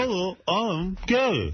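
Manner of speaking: slow, high-pitched put-on Gary voice; Gary impression. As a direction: drawl it out as Gary. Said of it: "a Gary impression".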